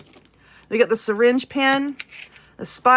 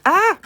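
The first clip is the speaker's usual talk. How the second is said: startled yelp; 8 semitones higher, 7 dB louder than usual. Real, not acted. Drops the microphone.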